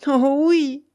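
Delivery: high, loud, ardent insistence; fiery. A narrator's reading. Rochaine insists to the astonished baron, with fire. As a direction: answer with fire. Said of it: "reprit Rochaine avec feu".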